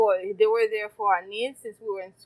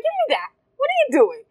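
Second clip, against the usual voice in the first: high, loud impatient demand; impatient child; 6 dB louder, faster than usual. Retold or imitated. Ten-year-old takes over from scared maid, impatient imitated demand. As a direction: act out snatching a job from someone hesitant. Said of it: "I was like".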